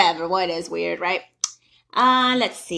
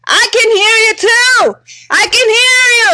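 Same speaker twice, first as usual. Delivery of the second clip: mock shouted reply, higher and louder; yelling back; 10 semitones higher, 7 dB louder than usual. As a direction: shout it right back at her. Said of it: "I was like".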